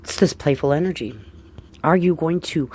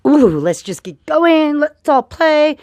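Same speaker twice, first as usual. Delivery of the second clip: high, slow and louder, teasing invitation; playful Lilith voice; 12 semitones higher, 6 dB louder, slower than usual. Acted. Astrologer voicing Lilith inviting everyone to play, high and teasing. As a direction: sing it out like a mischief-maker calling everyone to play.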